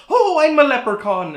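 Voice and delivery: high, loud fake Irish leprechaun accent; hammed-up stage-Irish leprechaun, high and loud. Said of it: "literally doing, like, leprechaun voices".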